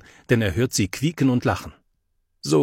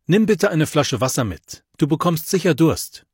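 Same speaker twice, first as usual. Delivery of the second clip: calling out; 6 semitones higher, 4 dB louder than usual. A narrator's reading. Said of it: "Da ruft Mama".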